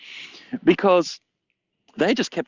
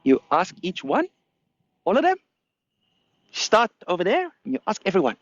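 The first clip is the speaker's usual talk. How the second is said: higher, teasing monkey; mock the visitor as the monkey; lightly voiced cheeky monkey; 7 semitones higher than usual. Monkey answers the question.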